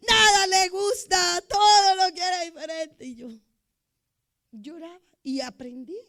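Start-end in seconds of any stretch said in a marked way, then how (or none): 0.1-3.0 high, loud, crying whine; mock crying whine, higher and louder; whiny complaint. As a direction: wail it like a fussy complainer. Mocking a complaining voice.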